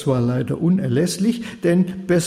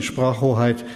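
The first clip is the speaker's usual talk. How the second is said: ordinary delivery, nothing marked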